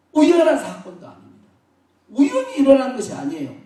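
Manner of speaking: high, loud, emphatic sermon delivery; full-force preaching. Real, not acted. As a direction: drive each phrase home from the pulpit.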